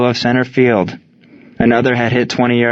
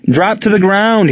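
high, urgent shouted warning; soldier's raised-pitch command; shouted order; 10 semitones higher than usual. A narrator's reading. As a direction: call it out high to get everyone down. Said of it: "he shouted".

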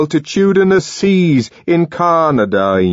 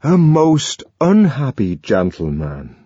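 slow, mournful sigh of a line; slow sad murmur; sorrowful; 4 semitones higher, slower than usual. A narrator's reading. Young man sighs sadly.

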